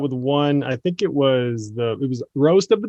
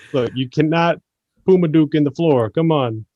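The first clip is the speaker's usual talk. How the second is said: ordinary delivery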